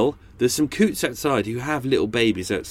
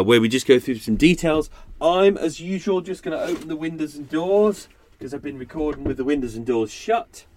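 normal talking voice

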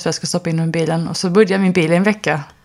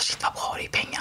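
whispered inner thought; 11 dB quieter than usual. Retold or imitated. Thinking to herself, delighted.

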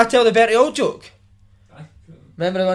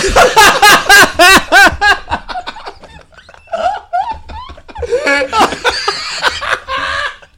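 long loud laugh; 6 dB louder than usual. Real, not acted. Laughing after own joke.

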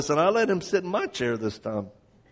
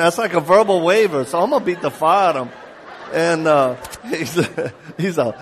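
usual speaking voice

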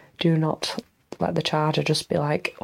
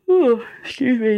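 yawning; 6 semitones higher, 5 dB louder than usual. Real, not acted. A tired podcaster yawning mid-ramble to listeners, yawning. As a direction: excuse me said mid-yawn, louder and higher. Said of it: "yawning my head off".

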